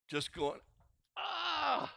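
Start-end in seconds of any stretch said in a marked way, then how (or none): 1.2-1.9 retold wordless scream. Preacher recalling his anguish by the pond, restrained wordless scream. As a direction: imitate crying out to God without words, high but held back. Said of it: "Just screaming out to God".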